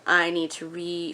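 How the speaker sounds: usual speaking voice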